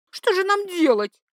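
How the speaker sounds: high, loud acted despair; desperate cry. A narrator's reading. Mistress begs her lover for a way out, desperate. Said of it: "отчаянно закричала его любовница".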